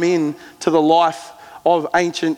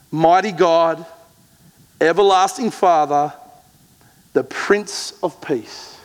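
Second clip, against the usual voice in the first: slow, reverent reading of the prophecy; solemn, fading to a hush at the end; scripture reading. Acted. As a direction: let each title land slowly, in awe. Reads Isaiah slowly.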